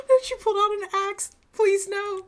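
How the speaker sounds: high, gasping plea; mock alarm. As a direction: gasp and plead in a high voice. Alarmed reaction to an axe.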